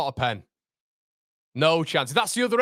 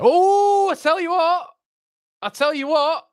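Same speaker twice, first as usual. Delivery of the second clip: excited outburst; 8 semitones higher, 5 dB louder than usual. Real, not acted. Live fan reaction to a foul.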